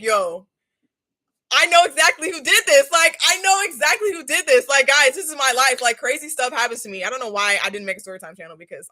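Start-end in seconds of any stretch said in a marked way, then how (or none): 0.0-7.0 louder and higher, fired-up certainty; heated rant. Retold or imitated. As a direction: vent it like you already know the culprit. She rants about who did it.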